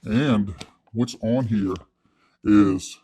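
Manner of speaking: low, soft, slow dramatic reveal; mock presenter. Presenting Wes's wallet blueprint to the audience, slow and hushed. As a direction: unveil the secret document gravely.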